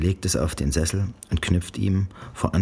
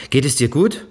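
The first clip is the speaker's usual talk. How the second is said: barsche Mutter; 10 semitones higher, 6 dB louder than usual. A narrator's reading. Mutter verhört Janice.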